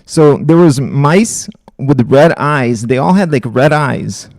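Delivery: loud, deliberate repeat for clarity; emphatic clarifying. Real, not acted. Streamer corrects viewer who misheard the mumbling, loud and emphatic.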